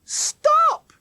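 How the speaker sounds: high, piercing, loud cry; repeated shout. A narrator's reading. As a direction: shout it out to halt everyone. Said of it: "Jackie shouted again".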